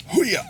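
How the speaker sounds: karate yell. Pretend. Returns to stream, battle cry.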